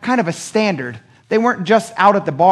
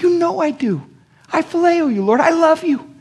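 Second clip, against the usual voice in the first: humble Peter pleading in a much higher strained voice; 14 semitones higher than usual. Retold or imitated. Peter answering Jesus on the shore, high and pleading. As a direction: plead it high and small, a man with nothing left to prove. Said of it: "And Peter's like".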